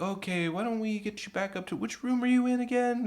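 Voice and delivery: high, soft, placating voice; careful, sing-song coaxing; coaxing a drunk. Retold or imitated.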